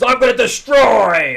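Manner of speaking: hammed-up superhero roar; mock battle shout. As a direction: bellow it like a cartoon hero.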